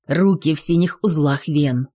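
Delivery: ordinary delivery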